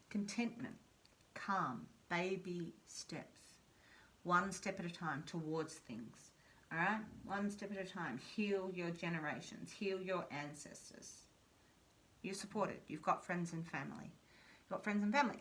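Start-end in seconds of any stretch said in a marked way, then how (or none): 0.1-12.8 hushed, slowed affirmations; soft reassurance. Acted. Reader soothing viewers with hushed calming affirmations. 12.9-14.1 softly spoken, almost breathed; hushed. Shown as a demonstration. Reader softly reassuring the viewer they have support. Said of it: "repeated line".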